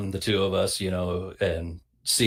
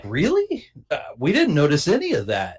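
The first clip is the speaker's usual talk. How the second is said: mock surprised parents; 6 semitones higher than usual.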